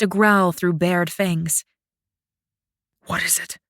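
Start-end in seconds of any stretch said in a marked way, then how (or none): 3.1-3.6 tense unvoiced whisper. Shang whispers to Fen, tense for attack. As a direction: breathe it out, ready for attack. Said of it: "Shang whispered".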